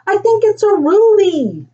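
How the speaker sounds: put-on high, thrilled child's voice; excited yelling out. A narrator's reading. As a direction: exclaim it with wonder, pitched high. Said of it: "yelled out Della".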